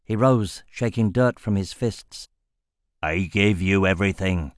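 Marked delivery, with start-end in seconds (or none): 3.0-4.5 deep, bitter growl; low growl. Acted. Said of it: "he growled".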